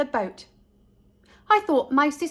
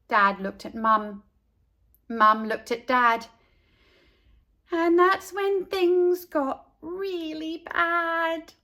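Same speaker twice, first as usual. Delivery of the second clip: plain reading voice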